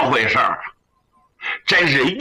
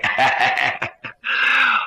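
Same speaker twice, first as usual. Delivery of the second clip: hearty apologetic laugh; 3 semitones lower than usual. Acted. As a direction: laugh it off boisterously.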